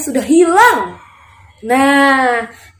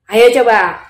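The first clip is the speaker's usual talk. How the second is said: usual speaking voice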